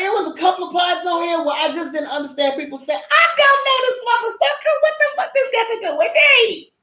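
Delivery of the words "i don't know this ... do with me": pitched way up, scornful brush-off; high, sneering "not my problem"; mock dismissive quote. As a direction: mimic someone who couldn't care less. Mocking dismissive drama characters.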